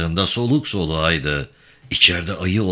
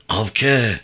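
first, restrained call of a name; mildly raised call, as if searching for someone. A narrator's reading. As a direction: call out with little force. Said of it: "diye bağırarak".

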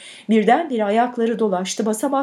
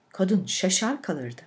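plain reading voice

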